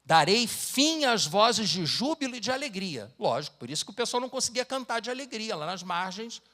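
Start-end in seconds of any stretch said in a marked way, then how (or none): 0.0-3.1 louder and higher, slowing to a heavy close; solemn scripture reading. Acted. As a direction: thunder out God's judgment. Reads scripture aloud.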